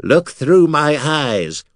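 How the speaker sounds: high, slow talking skull. Acted. Skull Mira guiding Artek, high and slow.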